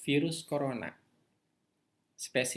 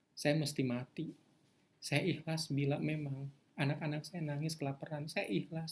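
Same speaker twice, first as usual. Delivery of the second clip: quiet surrender prayer; 5 dB quieter than usual. Shown as a demonstration. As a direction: pray it softly, giving everything up to God. (doa pasrah yang lirih dan tulus)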